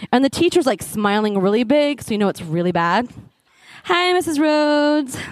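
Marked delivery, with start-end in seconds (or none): 3.8-5.2 slow, drawn-out sweet greeting, lightly voiced; a hint of a too-sweet teacher, slowly. Teacher greets the mother at the curb, lightly voiced sweetness. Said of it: "she had a cool Northern Irish accent".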